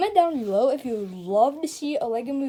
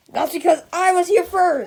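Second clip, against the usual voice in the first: louder, higher protest; loud insistence; 6 semitones higher, 8 dB louder than usual. Acted. Customer protests to the officer, loud. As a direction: stake the claim hard. Arguing with police.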